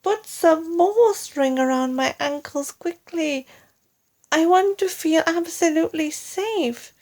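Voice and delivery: high urgent demand; anxious demand. Acted. Mrs Twit demands safety.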